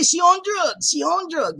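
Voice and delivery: high, loud mock gossip; mock accusation. Imitating viewers accusing her of being on drugs, high mock outcry. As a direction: exclaim it like a scandalized accuser. Mimicking what viewers will say.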